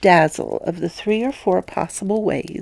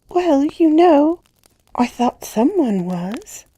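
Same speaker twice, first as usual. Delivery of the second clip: put-on high, slow little-girl voice with a smile; a child answering innocently, smiling; 10 semitones higher, 4 dB louder, slower than usual. A narrator's reading. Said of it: "as she smiled out that at me".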